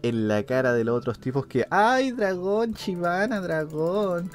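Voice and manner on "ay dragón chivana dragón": high, drawn-out nagging whine; whiny teammate mockery. Streamer imitating teammates begging for dragon, whiny.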